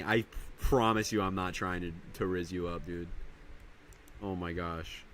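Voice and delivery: ordinary delivery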